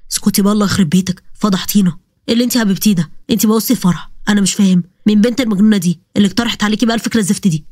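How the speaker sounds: a man snapping at his sister; angry brother. Acted. Walid yells at sister. (أخ غاضب بصوت رجالي منخفض)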